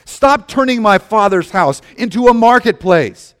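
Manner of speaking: loud, high, furious command; Jesus thundering at the dove sellers, angry shout; Jesus' angry shout. Acted. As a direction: drive them out with fury. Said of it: "he said".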